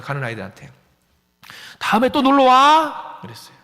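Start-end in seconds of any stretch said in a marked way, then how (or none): 1.8-3.2 loud, drawn-out friendly call; calling after kids. Retold or imitated. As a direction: call out warmly as they leave. Farewell call to leaving kids.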